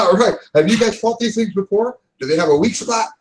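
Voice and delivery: excited character raring to fight. Acted. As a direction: ask it all keyed up. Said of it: "he looks excited".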